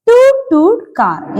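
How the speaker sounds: high, loud, drawn-out "Toot toot car"; playful car call. Shown as a demonstration. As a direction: sing it out like a happy little car. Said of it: "എന്താ കാറിന്റെ പേര്?". (ഉയർന്ന ശബ്ദത്തിൽ കളിയായി "Toot toot car")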